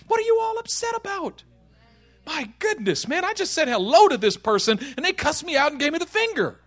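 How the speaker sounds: high-pitched, affronted protest; bewildered. Acted. Cussed out after saying hello, bewildered.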